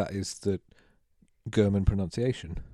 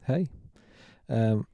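ordinary talk

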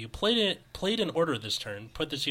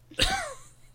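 high laugh. Real, not acted. Laughs after the fop voice.

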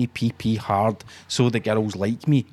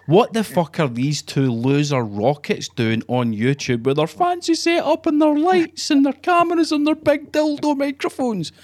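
sneering troll viewer; 11 semitones higher than usual. Retold or imitated. Young viewer mocking the two podcasters' fancy setup, high sneering put-on voice. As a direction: sneer at the two hosts like a scornful teenage viewer. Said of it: "and he's thought".

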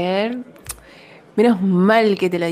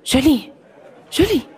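calling out; 3 semitones higher, 4 dB louder than usual. Acted. Boss calls coworker to office.

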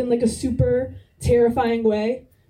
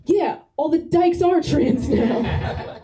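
slow, higher, overconfident mock Trump delivery; Trump-like confident drawl; 5 semitones higher, slower than usual. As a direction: say it like a blustering president sure of every word. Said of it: "you've ever sounded like Donald Trump".

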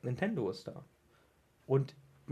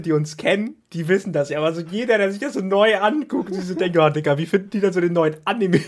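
laughing; 4 semitones higher, 6 dB louder than usual. Real, not acted. Building up while laughing.

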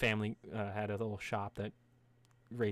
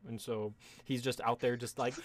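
usual speaking voice

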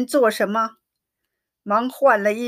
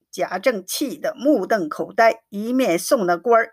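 usual speaking voice

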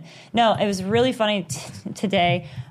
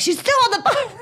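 very high, squealed mock panic; frantic, high-pitched overreaction; retold freak-out; 4 dB louder than usual. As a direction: shriek it as a comic replay of your panic. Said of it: "It's like this whole freak out".

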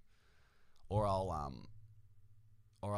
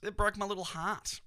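mock heartbreak; 12 semitones higher, 4 dB louder than usual. Mock heartbreak over loss.

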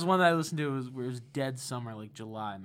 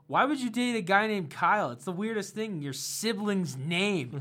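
mocking host; 7 semitones higher, 7 dB louder than usual.

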